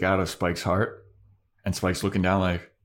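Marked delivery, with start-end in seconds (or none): none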